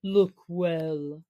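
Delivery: low, steady wolf-leader voice; a deep call to look; Akela. Acted. Old wolf chief calling the pack to look, low and steady.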